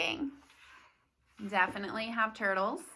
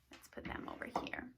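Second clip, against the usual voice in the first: quick little whisper; 14 dB quieter, faster than usual. Real, not acted. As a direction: whisper it fast under your breath. Aside while moving the toys.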